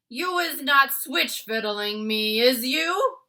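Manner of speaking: loud, slow giant voice rising to a suspicious question; the giant grilling the BFG about the vegetable; suspicious Bloodbottler. A narrator's reading. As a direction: lean in, distrustful and booming. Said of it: "said the blood bottler".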